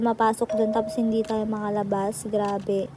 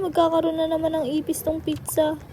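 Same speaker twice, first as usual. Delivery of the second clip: usual speaking voice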